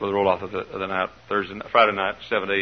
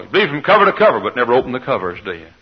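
rising rebuke; 6 dB louder, faster than usual. Real, not acted. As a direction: needle them, pitch up and push. Preacher chiding congregation.

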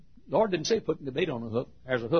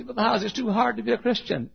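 higher-pitched protesting question; imitated objection; 11 semitones higher than usual. Preacher mimicking a listener's objection, protesting. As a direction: voice a doubter's high-pitched protest. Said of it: "You say".

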